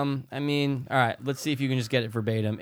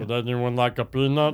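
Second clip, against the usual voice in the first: lightly voiced gentle giant; Andre the Giant voice. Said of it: "doing the Andre the Giant voice".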